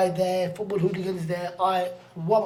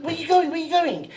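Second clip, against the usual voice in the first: put-on much higher, louder child's cry; upset little girl; 10 semitones higher, 4 dB louder, faster than usual. Little girl grabs new friend leaving the train, high urgent child voice. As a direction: call out like a little girl being left.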